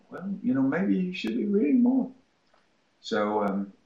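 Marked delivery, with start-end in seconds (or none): 0.1-2.1 teasing retort. Retold or imitated. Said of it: "teacher retort".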